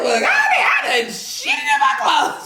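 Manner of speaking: admitting the accident, a high laughing shriek. Retold or imitated. Retold shock, laughing.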